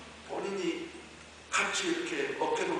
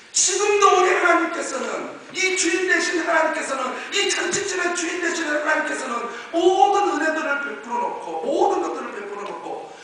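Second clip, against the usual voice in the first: loud, high preacher's cry; fervent sermon voice, raised and urgent; impassioned preaching; 10 semitones higher, 10 dB louder than usual. Real, not acted. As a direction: preach it at full force. Preaches at full force.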